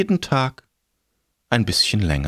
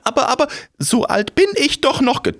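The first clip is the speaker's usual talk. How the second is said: high, flustered crone stammer; panicky old woman; 3 dB louder, faster than usual. Acted. Old woman protests fast.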